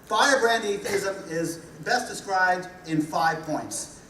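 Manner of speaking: ordinary delivery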